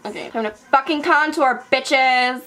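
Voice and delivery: loud, high hype call; rally the viewers to contour; shouted like a hype host; hyped announcement. Real, not acted. Hyping up next step.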